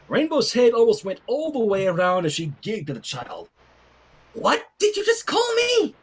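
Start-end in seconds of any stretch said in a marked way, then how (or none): 4.3-5.9 high, incredulous question; can't believe your ears; shocked disbelief. Acted. Rainbow startled by the baby's insult, high and incredulous.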